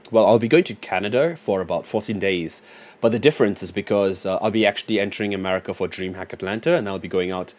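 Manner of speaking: put-on low, quiet American-style English accent; clean English accent. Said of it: "This dude spoke the cleanest English".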